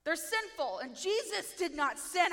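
ordinary talk

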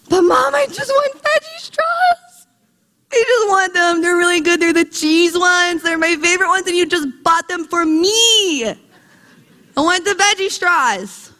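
imitated bratty whine, high and stretched; whining child. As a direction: plead and whine like a thwarted four-year-old. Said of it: "with the whining".

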